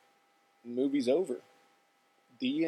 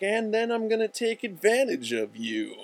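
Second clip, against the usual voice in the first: creepy bum; 9 semitones higher, 3 dB louder than usual. Retold or imitated. Bum reveals creepy intent.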